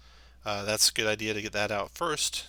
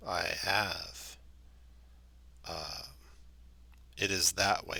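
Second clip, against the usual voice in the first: talking tree; 3 semitones lower, 5 dB quieter, slower than usual. Acted. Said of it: "slow voice".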